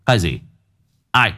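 gruff husband snapping at the crying family; a risen man barking at his family, fast at the end; booming voice. Acted. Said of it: "bật giọng sang sảng". (giọng ông quan sang sảng, quát gắt)

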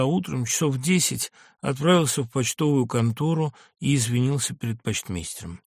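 usual speaking voice

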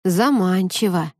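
slow musing drawl; drawn out. Acted. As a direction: stretch it out, pondering. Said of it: "протянул Никита".

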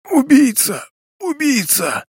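hoarse dying cry. A narrator's reading. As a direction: wheeze out the accusation. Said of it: "Ещё раз, хрипя, произнёс МакИнтайр".

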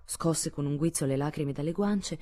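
plain reading voice

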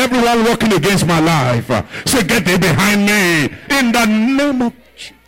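loud commanding rebuke, pushed hard; forceful rebuke. Shown as a demonstration. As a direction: rebuke the enemy at full voice.

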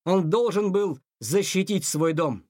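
plain reading voice

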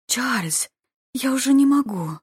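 high, lightly acted female moan; moaning plea. A narrator's reading. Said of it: "застонал женский голос". (слегка наигранный высокий стон)

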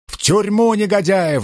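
narrated outraged yell; high yell. A narrator's reading. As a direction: cry out for arrest, pitch leaping up. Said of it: "завопил один".

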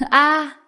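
high, loud scream of fright; terrified scream. Acted. Frightened boy who has just seen a ghost, high scream. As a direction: scream out in panic. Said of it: "sợ tới mức".

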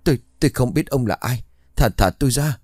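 high, fast, strangled plea; a man being strangled begging the ghost to let go; choked voice. Acted. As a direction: gasp it out while being throttled. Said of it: "giọng đầy ứ nghẹn".

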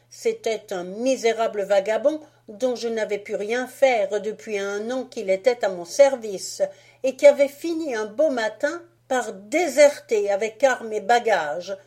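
put-on loud, swaggering boy's lecture; Fritz boasting. Fritz to Marie about discipline, loud and boastful. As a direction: lecture her like a pompous little officer.

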